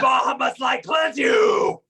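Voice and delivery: high holy war shout; crusader's ringing cry of smiting; triumphant battle cry. Acted. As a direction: shout divine judgment as the blow lands. Battle cry as smite lands.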